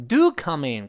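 emphatic "Do come in"; stress on do. Shown as a demonstration. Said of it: "do也是读得高了吧".

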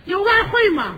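high, loud bird voice; greedy bird. Acted. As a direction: squawk the demand like a mynah. Bird asks for foreign currency. (尖声学鸟要外汇)

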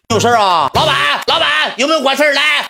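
high mimicking voice calling out for the boss; imitating the tomboy's high call for the boss; mock call for the boss. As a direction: pitch it way up, imitating her demanding the boss.

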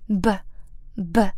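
lips shut, then a puff bursts the b; plosive b. Shown as a demonstration. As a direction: burst the b with air. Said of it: "双唇紧闭，然后用气流冲破开发". (双唇爆破发b)

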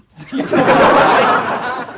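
high breathy laughter. Real, not acted. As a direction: burst out laughing.

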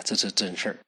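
hushed, secretive whisper; breathy confiding whisper. A narrator's reading. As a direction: lean in and whisper it.

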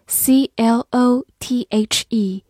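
slow letter-by-letter spelling; spelled out letters. Shown as a demonstration. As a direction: spell out "C L O T H E" slowly. (慢慢拼读字母)

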